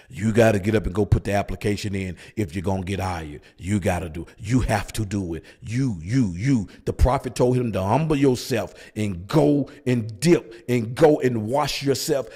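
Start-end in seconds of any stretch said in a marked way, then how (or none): 0.1-6.7 deep voice. Shown as a demonstration. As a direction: drop the voice way down and lean on each you. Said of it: "Let me say it with a deep voice".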